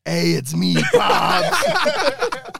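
high, loud cartoon old man voice with a laugh; giggly high-pitched Pops voice; Pops impression. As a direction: ham up the jolly old gentleman. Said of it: "I could do a Pops impression".

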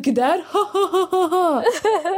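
high, cackling mock laugh at the dialect; imitating relatives laughing at her Somali; mocking laughter. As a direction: laugh down at the word like a teasing relative. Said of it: "They're gonna be like".

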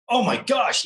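lightly acted amazed delight; retold excitement. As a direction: relive the thrill of the offer. Retold thrill at getting race offer.

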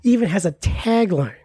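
ordinary delivery

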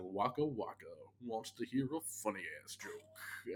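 hushed, husky mock puppet comedian; wrong-sounding Muppet. Podcaster quoting a Family Guy gag, wrong-sounding Muppet voice. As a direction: do a badly off Muppet impression, half-whispered. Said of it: "the wrong sounding Muppets from Family Guy".

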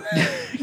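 short high-pitched laugh. Real, not acted. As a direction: burst into a squeaky laugh. Laugh after punchline.

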